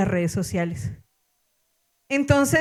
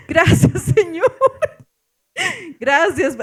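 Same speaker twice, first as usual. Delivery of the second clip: gratitud aliviada; 7 semitones higher, 4 dB louder, slower than usual. Retold or imitated. Aliviada al bajar del avión.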